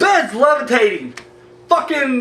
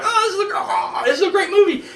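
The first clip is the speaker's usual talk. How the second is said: higher-pitched imitation of gushing fans; mock gushing; 7 semitones higher than usual. Mimicking people who loved a bad movie, mock gushing. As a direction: gush like an easily impressed moviegoer, hammed-up.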